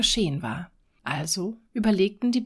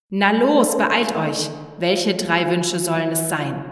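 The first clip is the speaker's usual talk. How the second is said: loud shouting; 6 dB louder than usual. Acted. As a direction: bellow at them to hurry. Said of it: "schrie mit lauter Stimme". (laut schreiend)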